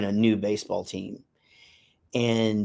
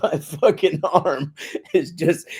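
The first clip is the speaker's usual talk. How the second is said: laughing; 4 semitones higher, slower than usual. Real, not acted. Laughing about sore arm.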